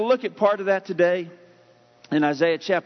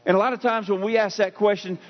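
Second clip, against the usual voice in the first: usual speaking voice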